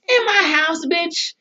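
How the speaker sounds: high-pitched, scandalized indignation; outraged disbelief. Acted. Speaker acting outraged at betrayal in own house, indignant. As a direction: pitch it up, affronted. Caps the acted indignant rant.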